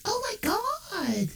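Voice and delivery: call-center rep faking sympathy; phone rep Mindy. Acted. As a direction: gush over the caller with fake pity. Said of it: "she comes in".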